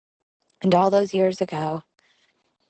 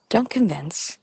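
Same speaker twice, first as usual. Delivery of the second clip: normal talking voice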